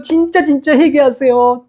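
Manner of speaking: slow, pressing plea on 진짜; 진짜 stressed, whole line unhurried; pleading emphasis. Acted. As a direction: lean hard on 진짜, slowly. Repeated plea to repent.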